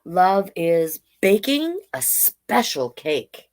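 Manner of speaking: slow, sing-song picture-book read; reading to children. Acted. Drag queen reading a picture book to kids, slow and sing-song. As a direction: read each page slowly to the little ones.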